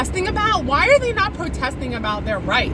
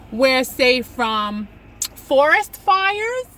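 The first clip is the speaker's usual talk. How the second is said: ordinary talk, nothing marked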